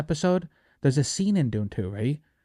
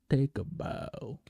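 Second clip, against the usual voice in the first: slow and hushed, softer, a grand bow; solemn tribute; 2 semitones lower, 10 dB quieter, much slower than usual. Pretend. Crowning Paul Atreides with a slow, hushed tribute. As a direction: lay it down slow and quiet like a ceremony.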